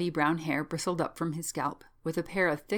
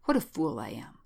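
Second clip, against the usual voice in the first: lower-pitched, understated self-reproach; 5 semitones lower than usual. Acted. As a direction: berate yourself in a lowered pitch. Shaken man berates himself, first take.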